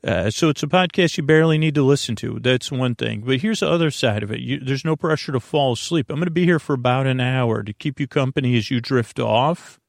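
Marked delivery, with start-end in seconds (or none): none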